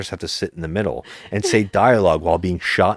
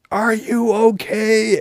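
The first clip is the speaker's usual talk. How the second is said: high, slow mock heroine concern; much slower than usual. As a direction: drag out the fake worried question. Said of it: "she goes".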